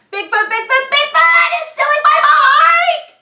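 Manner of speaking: high, loud frantic yelling; panicked shout. Acted. Ben yelling that Bigfoot took his bike, loud and frantic. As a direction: scream for help, half laughing.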